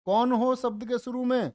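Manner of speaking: ordinary delivery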